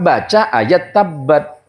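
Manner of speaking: ordinary delivery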